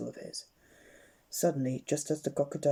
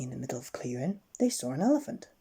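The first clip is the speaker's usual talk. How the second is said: plain reading voice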